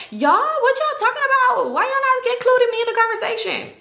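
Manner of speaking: high, loud whiny call through a door; calling out from stall. Retold or imitated. Clingy calling from the bathroom stall, high whiny shout.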